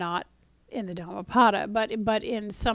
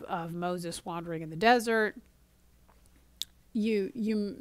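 normal talking voice

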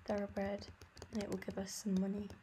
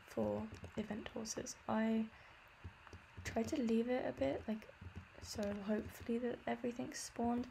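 ordinary talk, nothing marked

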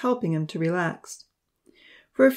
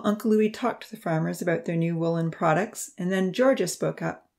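ordinary delivery

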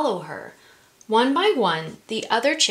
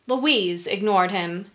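usual speaking voice

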